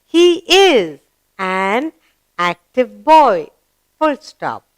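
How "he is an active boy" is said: slow, word by word; drawn-out classroom reading; read-along model. Shown as a demonstration. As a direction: read it out with gaps for learners to follow. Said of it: "let's read the sentence".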